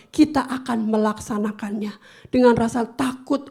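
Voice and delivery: normal talking voice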